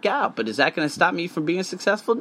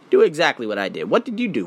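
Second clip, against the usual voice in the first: ordinary talk, nothing marked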